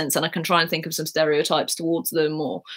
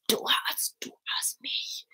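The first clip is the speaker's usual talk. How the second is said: whispered [name] imitation; 5 semitones higher, 11 dB quieter than usual. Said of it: "Most people are like".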